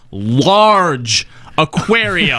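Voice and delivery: LARGE AQUARIUM drawn out very slowly, word by word; emphatic, capitalized. Retold or imitated. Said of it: "he's emphasizing that crafters need to".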